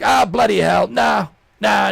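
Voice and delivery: hammed-up British boss yelling no; mock British shout. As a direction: shout it like a fed-up British exec. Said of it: "Jim Ryan's like".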